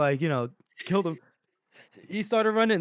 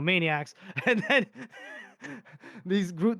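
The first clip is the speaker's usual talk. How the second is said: normal talking voice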